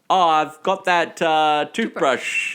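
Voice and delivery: loud, high and slow, drawn-out mock casualness; awkward bluffing. Awkward guest telling the concierge about his toothbrush, hammed-up bluff. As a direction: overplay a clumsy excuse, stretching the last word.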